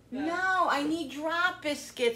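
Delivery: insisting on drop biscuits; mild frustration. Real, not acted. Frustrated at wrong recipe.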